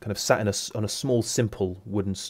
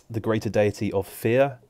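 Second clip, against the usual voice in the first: normal talking voice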